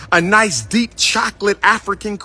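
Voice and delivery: normal talking voice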